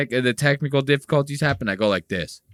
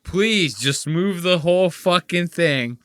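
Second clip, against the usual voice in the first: mock begging viewer. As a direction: beg for the show time shift. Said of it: "This person said".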